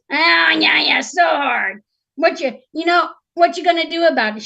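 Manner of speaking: loud, high sarcastic sympathy; mocking talking frog. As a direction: jeer at her troubles as the frog. Frog mocks the princess's complaint.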